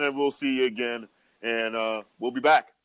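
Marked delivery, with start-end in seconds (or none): none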